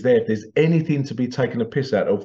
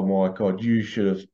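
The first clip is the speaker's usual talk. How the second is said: normal talking voice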